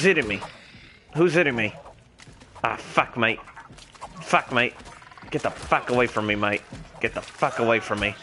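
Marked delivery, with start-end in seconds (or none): none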